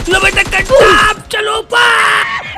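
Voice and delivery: very high, loud drawn-out gloat; loud gloating shout over a downed enemy; victory shout. Real, not acted. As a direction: stretch out the taunt over the kill. Taunting a killed enemy.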